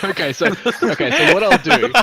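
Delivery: talking through laughter; giggling while explaining; laughing. Real, not acted. Laughing at the chaos.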